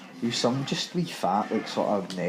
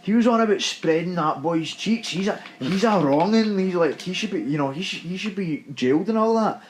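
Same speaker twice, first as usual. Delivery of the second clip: mock accusing yell, pitched higher; retold shouting; 6 semitones higher than usual. Retelling an angry man yelling accusations to everyone in the queue: raised mock shout. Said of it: "pure shouting to everybody".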